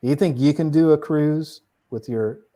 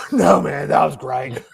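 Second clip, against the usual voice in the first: winded friend; 4 dB louder than usual. Retold or imitated. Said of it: "He goes".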